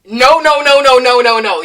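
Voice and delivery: loud, insistent no no no; loud mocking no. Mocking the teacher who rejects rock, loud. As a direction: hammer the no's like the pushy teacher.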